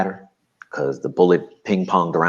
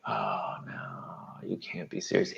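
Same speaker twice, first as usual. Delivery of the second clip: breathy whispered dismay; 9 semitones lower, 15 dB quieter, slower than usual. Retold or imitated. Silent dismay, can't talk.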